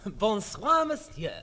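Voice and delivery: put-on lightly done French waiter accent; bow and greet in mild French accent. Waiter greeting the gentleman in a light French accent. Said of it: "that ridiculous regional accent".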